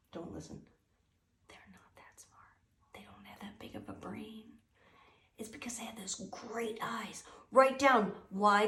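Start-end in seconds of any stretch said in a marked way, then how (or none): 0.1-7.2 hushed, confidential whisper; secretive whisper behind its back; whispered aside. Pretend. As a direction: whisper it so the subject can't hear. Confidential aside about the owl.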